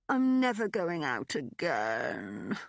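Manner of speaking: slow, drawn-out groan. A narrator's reading. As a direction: groan it into your hands. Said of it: "I'd groaned".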